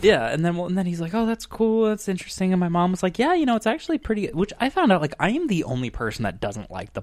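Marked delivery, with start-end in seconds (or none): 1.2-2.5 higher mock dad voice; imitating dad. Dad voiced in retold chat. 3.2-4.3 high, fast mock mom voice; imitating mom. Mom voiced in retold chat.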